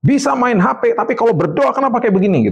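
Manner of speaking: very high, exasperated mock scolding; retold angry parent. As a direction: act out telling off children over their praying. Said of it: "saya pernah marah kayak begitu".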